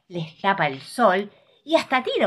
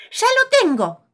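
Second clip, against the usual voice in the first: pig shouts with a sudden idea, much higher and louder; triumphant yell; excited shout; 10 dB louder than usual. Acted. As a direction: burst out with the eureka. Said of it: "grita entonces el chancho".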